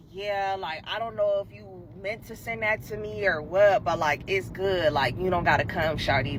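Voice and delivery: very low, hushed guy voice; imitating laid-back guy. Boy tells her not to come, imitated low and quiet. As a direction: mimic him low, quiet and offhand.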